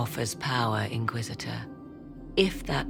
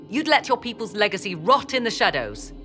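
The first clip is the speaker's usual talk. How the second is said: high-pitched scornful reproach; outraged, with the pitch lifted high; indignant rebuke; 7 semitones higher, faster than usual. Acted. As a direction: lash out in indignation. Scolds elf over the well.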